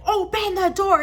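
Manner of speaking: high, stretched command; imitated officer's demand. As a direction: mimic a cop calling through the door.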